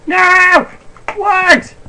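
high loud startled shriek; shocked cry. Real, not acted. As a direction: cry out in disbelief. Chickens suddenly lost.

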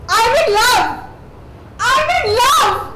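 rising passion. Acted.

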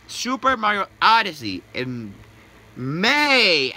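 May drawn out, high and loud; stress on May. Real, not acted. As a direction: punch and stretch the word May. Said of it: "Emphasis on the word may".

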